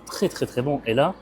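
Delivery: ordinary talk, nothing marked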